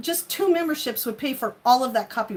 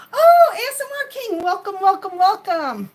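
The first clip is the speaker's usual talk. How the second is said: high, loud gushing welcome; excited welcome; 7 semitones higher, 6 dB louder than usual. Real, not acted. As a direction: greet him with a big cry of joy. Welcoming a chat viewer.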